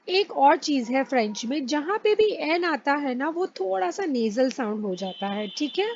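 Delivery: normal talking voice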